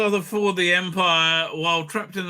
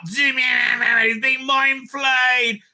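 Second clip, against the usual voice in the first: dragged-out shout; 9 semitones higher, 3 dB louder, much slower than usual. Acted. Narrating the flaying moment.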